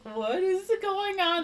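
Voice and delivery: very high, drawn-out question; slow, high put-on disbelief; mock bewilderment. She reacts to the mystery luggage, mock bewilderment.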